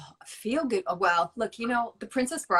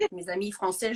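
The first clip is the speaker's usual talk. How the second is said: ordinary talk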